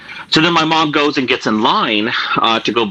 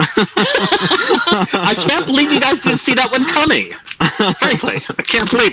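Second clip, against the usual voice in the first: laughing; 12 semitones higher, slower than usual. Real, not acted. Laughing at his own prank.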